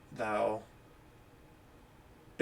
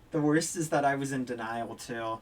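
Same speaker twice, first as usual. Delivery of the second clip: ordinary delivery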